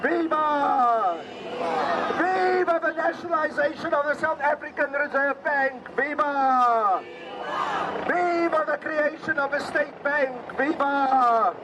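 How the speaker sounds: shouted rally chants; booming ideological slogans. Real, not acted. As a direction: rouse the crowd with slogans. Rally speaker leads call-and-response chants.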